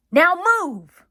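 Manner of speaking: high, loud barked order; angry boss shouting at the elephant; loud command. Acted. Circus boss threatens elephant.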